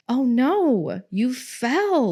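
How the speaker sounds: put-on high, sing-song soothing to a small child; calm and soothing. Mom comforting her daughter after a fall, high soothing voice. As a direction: reassure a hurt toddler gently. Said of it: "I went over really calmly".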